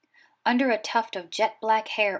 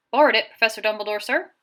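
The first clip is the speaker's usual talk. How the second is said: quick, loud giant's answer; 7 dB louder, faster than usual. A narrator's reading. Giant Hagrid answers the headmaster, loud and quick. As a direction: blurt it out like a big eager giant. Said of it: "said the giant".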